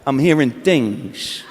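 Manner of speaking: low, slow, soft mobster mumble; a quiet, menacing De Niro murmur; Robert De Niro. Pretend. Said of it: "I turn into Robert De Niro".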